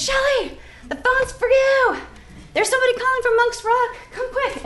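a nun calling out the news, high and breathless with excitement; excited nun. Retold or imitated. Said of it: "She says".

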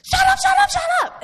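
very high, very loud yelled shut up; screaming. Retold or imitated. Front of the bus yelling at the back, screaming. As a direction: shriek it at the kids in the back. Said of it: "the front of the bus is screaming at us".

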